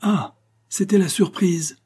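high, bright cry of sudden understanding; surprised exclamation. A narrator's reading. Guests realize the surprise, crying out in chorus. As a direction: cry out together as it dawns on them. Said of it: "la société s'écria en chœur".

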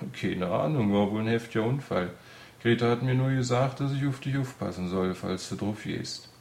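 breezy, tactless odd fellow; carefree odd man. Acted. Odd man explaining the accident to the patient, carefree.

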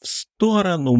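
high, piercing warning shout; wild yell. Acted. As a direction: scream the warning across the room. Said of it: "Диким криком заорал Кокос".

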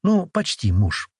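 slow, grudging correction; reluctant. Acted. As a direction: admit it unwillingly, drawn out. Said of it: "потом нехотя уточнила".

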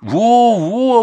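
light up with recognition; grandma glad the boy guessed; delighted. Acted. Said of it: "обрадовалась баба Дунь".

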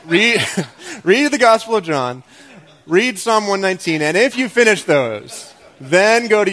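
loud, high, laughing plea; excited laughing urging; pleading excitedly. Real, not acted. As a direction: beg them to read it, half laughing. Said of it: "Okay, please".

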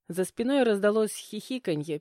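ordinary delivery

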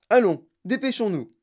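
high, loud chivvying; urgent hurrying. A narrator's reading. Wonka to the children, hurrying them on urgently.

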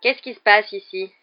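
lightly drawled, nosy demand; drawling voice. A narrator's reading. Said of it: "la voix traînante de Drago Malfoy".

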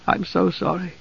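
soft, high, broken murmur; hushed and grief-stricken; brokenly. A narrator's reading. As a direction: barely get the apology out. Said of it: "he said brokenly".

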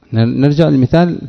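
ordinary delivery

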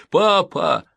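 put-on high, loud child's wail; a boy calls for his father; child crying out. A narrator's reading. Boy cries out to the sergeant in a high wail.

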